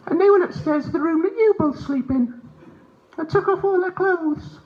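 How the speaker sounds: very high, squeaky cat voice; talking cat. Acted. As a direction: speak as a tattling cat, way up high. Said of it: "What if the cat is actually saying to me".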